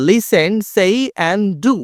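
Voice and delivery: slow, deliberate reading of the instruction; slow read-aloud. Shown as a demonstration. Teacher reading the task heading slowly to pupils. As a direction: read it word by word for learners.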